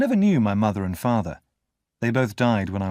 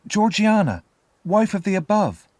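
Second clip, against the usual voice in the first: put-on high-pitched child reciting; anxious little boy; 10 semitones higher, 5 dB louder than usual. A narrator's reading. Boy names his mother to the convict, anxious.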